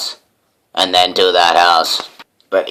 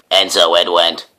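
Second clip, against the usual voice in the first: usual speaking voice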